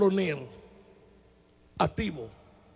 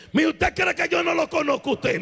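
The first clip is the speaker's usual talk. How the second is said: mock threat; 6 semitones higher than usual. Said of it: "Me dijo así".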